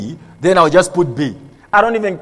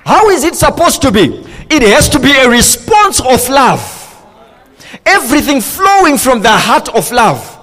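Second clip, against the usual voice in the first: higher-pitched, emphatic sermon delivery; impassioned preaching; 5 semitones higher, 3 dB louder than usual. Real, not acted. Preacher denouncing fear in marriage, impassioned and emphatic. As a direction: drive each declaration home to the congregation.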